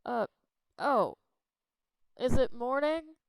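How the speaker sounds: Jack, shaken awake, dazed; groggy Jack. Acted. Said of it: "shakes awake and goes".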